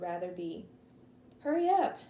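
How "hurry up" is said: calling out loudly across the creek; shouting. A narrator's reading. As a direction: shout it, high and loud. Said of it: "Dewey shouted".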